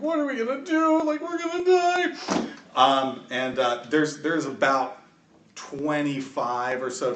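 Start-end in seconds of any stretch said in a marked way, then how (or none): 0.0-2.1 high-pitched acted despair; much higher, fretting voice; panicking townsperson. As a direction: wail it as a hopeless villager. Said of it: "starts massaging his head and is like".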